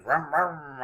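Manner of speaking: vroom like a revving engine; playful car engine revs; revving engine noise. Pretend. Teacher starting a counting race, playful engine vrooms. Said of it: "rev your engines".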